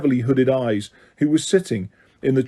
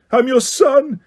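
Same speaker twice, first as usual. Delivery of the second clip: much higher, louder desperate scream; 12 semitones higher, 3 dB louder, slower than usual. A narrator's reading. Son to his father, desperate scream. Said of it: "he screamed up at Crouch".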